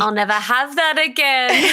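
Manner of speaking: laughed-through quote of her own tears; laughing through it. Retold or imitated. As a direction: say it half-laughing at your past self. Retelling crying over lost mum.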